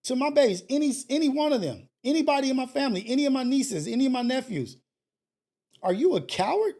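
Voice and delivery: demanding roll call of the family. Real, not acted. Calling out family members.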